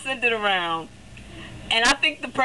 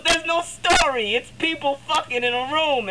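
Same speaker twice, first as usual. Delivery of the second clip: higher mock scorn; lightly voiced friend scoffing; imitating scornful friend; 6 semitones higher than usual. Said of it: "She goes".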